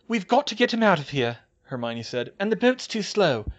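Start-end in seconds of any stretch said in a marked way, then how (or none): 0.1-1.4 high, loud urgency; tense, pitched-up appeal; Hermione's voice. A narrator's reading. Hermione urges escape, voiced by narrator. 2.4-3.5 high, loud urgency; tense, pitched-up appeal; Hermione's voice. A narrator's reading. Hermione urges escape, voiced by narrator.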